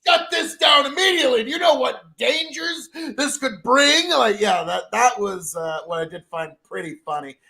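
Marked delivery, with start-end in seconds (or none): 0.1-4.1 higher and shouted, playing a scandalized rich man; mock outraged elite. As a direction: ham up a panicking hedge fund boss. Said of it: "the hedge fund and the elites are all like".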